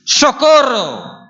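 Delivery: high, drawn-out yell; desperate cry for help. Shown as a demonstration. No time for manners or looks, desperate shout. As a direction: cry out with no care for appearances. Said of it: "Ahí no hay tiempo para".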